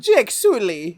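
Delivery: high, loud Caribbean-style Miss Cleo lilt; Miss Cleo accent. Retold or imitated. Imitating the Na'vi girl calling Jake Sully, Miss Cleo style. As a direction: say his name like Miss Cleo. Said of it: "she sounds like Miss Cleo".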